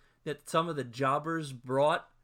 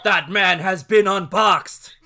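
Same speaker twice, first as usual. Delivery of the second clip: very loud, higher, slow mock commentary; shouting wrestling announcer; 5 semitones higher, 13 dB louder than usual. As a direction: bellow each word like a stunned commentator. Joins in shouted announcer impression.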